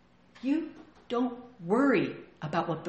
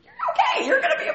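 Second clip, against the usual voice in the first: very high, laughing comfort; laughing reassurance; 8 dB louder than usual. Retold or imitated. Pastor comforting girlfriend, laughing reassurance. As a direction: reassure her through a laugh.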